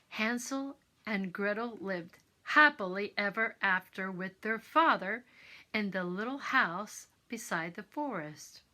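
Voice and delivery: usual speaking voice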